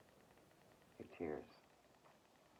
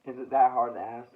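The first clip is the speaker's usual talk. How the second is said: much louder, pleading in frustration; exasperated outburst; 3 semitones higher, 15 dB louder than usual. Real, not acted. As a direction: burst out at the listener, fed up. Exasperated outburst about crying.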